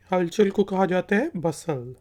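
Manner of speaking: usual speaking voice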